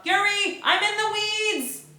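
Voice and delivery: high, loud, stretched shout. Acted. Waitress shouting to Yuri for help, loud high shout. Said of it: "I shout".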